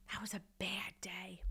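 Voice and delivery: soft rueful whisper. Real, not acted. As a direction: breathe it out like a confession. Rueful aside after humiliation.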